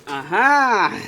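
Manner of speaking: loud, high triumphant cry; triumphant aha. Real, not acted. Owner triumphantly spotting the bird the cat hid. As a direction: pounce on the discovery.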